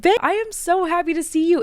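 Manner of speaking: high, bright imitation of Terry's greeting; friend's happy greeting. Late friend greeting her in a dream, a high happy imitation. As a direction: lightly voice her joyful hello to you.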